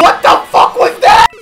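high, fast shocked yell; shocked shout. Real, not acted. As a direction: blurt it out in disbelief. Shocked at game event.

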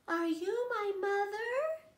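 put-on baby bird asking. Baby bird asking a kitten if it's his mother, high hopeful chick voice. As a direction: ask like a tiny lost chick. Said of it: "the baby bird asked a hen".

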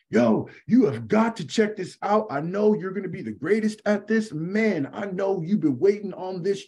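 mock-excited, pitched high, salesy DM pitch; hyped spam pitch. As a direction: imitate an overeager recruiter. Spammy recruiter pitch in DMs.

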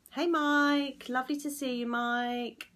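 normal talking voice

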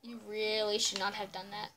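low, hushed warning; quietly threaten, pitched low in play; mock menace. Mock threat after dogs attack.

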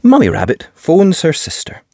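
ordinary delivery, nothing marked

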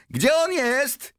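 very high, drawn-out call. A narrator's reading. Someone in the crowd asks where he is, calling out. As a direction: call loudly over a crowd. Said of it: "wołał ktoś".

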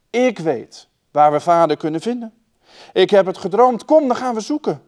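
put-on eager girl. A narrator's reading. Dunja waking her brother to find father, eager and rising. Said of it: "maakte Dunja haar broertje wakker en zei".

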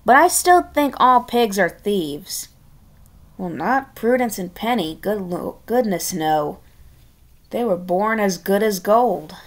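plain reading voice

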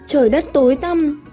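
usual speaking voice

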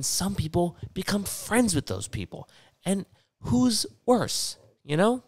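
very high put-on voice, stretching the words; mocking imitation. Mimics those people's friends.

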